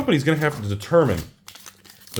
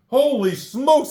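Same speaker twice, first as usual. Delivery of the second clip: excited exclamation; 11 semitones higher, slower than usual. Real, not acted. Celebrating another pull.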